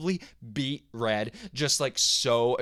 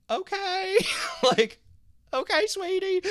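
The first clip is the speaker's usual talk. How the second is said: much higher, gentle mom okay; soothing mom voice; 3 dB louder, slower than usual. Retold or imitated. Mom calms her sobbing son on the phone, high and gentle. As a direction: coo it way up high like a mother calming a child.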